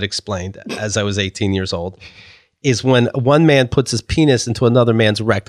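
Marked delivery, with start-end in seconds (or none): none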